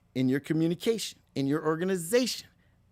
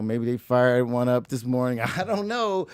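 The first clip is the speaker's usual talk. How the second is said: ordinary talk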